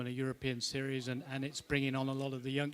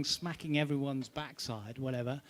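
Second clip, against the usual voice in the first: ordinary delivery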